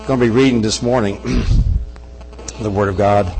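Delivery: ordinary delivery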